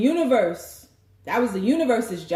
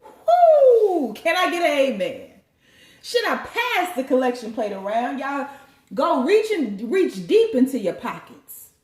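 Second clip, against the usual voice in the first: hammed-up loud preacher whoop; mock preacher; 3 semitones higher, 4 dB louder than usual. The reader plays a church preacher asking for money. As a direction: rouse the congregation for the collection plate.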